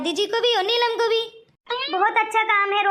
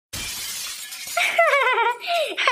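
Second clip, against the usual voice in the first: giggle; 2 semitones higher than usual. Acted. Girl laughs at shocked boy.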